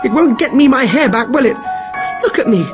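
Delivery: wife furiously berating her husband over her lost hair; shouting angrily. Acted. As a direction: scream at the useless husband. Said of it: "shouted at her husband".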